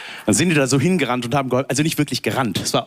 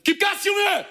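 bellowing operator. Retold or imitated. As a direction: bark it across the bumper cars. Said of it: "angebrüllt".

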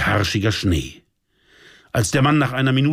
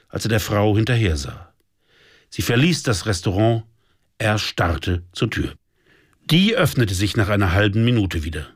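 usual speaking voice

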